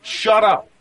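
high, sudden mock yell; imitated angry shout. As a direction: act out a gamer telling off a noisy player. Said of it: "Greg stood up and goes".

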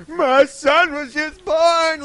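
high, slow mock wail; mock hysterical passenger. Panicking passenger on the delayed plane, high mock wail. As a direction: ham up a panicking new father.

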